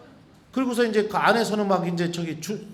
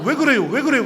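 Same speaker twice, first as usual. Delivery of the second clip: higher, louder alarmed question; startled asking; 6 semitones higher, 6 dB louder than usual. Retold or imitated. Priest startled, asking his pregnant sister-in-law. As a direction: blurts it out in a fright.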